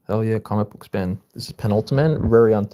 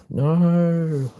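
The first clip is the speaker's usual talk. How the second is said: cry of protest; 9 semitones higher than usual. Acted. Child cries out.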